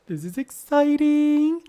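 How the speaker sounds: high squeal of excitement; excited. Real, not acted. Fan art reviewer squeals with delight over the art. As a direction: gush about it, way up high.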